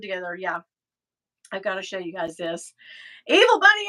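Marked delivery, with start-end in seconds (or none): none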